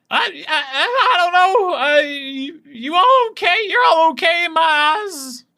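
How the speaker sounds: very high, squeaky and giggly; penguin person voice. Acted. A penguin person fusses over the ooze, squeaky and high. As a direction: squeak it out like a flustered little penguin.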